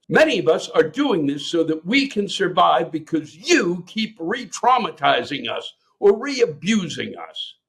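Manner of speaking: high-pitched, swooping outrage; indignant rant reading. Acted. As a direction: rail at the parents on someone's behalf. Performed reading of listener's rant.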